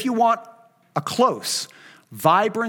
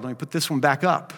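normal talking voice

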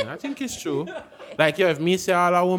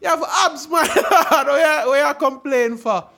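much higher, laughing mock complaint; high laughing imitation of a jealous guy; mock envious friend; 11 semitones higher, 5 dB louder, slower than usual. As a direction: pitch it way up and laugh through it. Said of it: "man, say".

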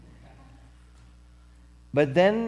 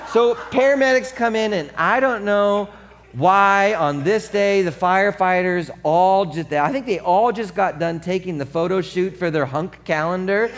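usual speaking voice

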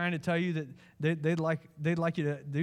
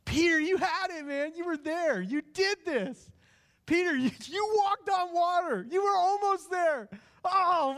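much higher, louder, urgent cheering; passionate pleading; 13 semitones higher, 4 dB louder than usual. Acted. Jesus urging Peter he had done it, high and impassioned. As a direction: pour it out like a proud coach who can't believe it. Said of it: "because inflection matters".

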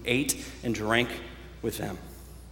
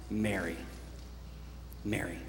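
soft, lower, gently falling name; tender calling; 3 semitones lower, 11 dB quieter than usual. Acted. Jesus speaks Mary's name in the garden, soft and tender. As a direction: say the name quietly and intimately, letting it fall.

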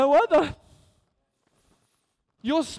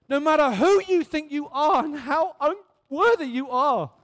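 ordinary talk

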